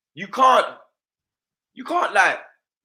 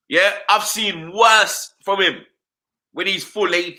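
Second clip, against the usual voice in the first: ordinary talk, nothing marked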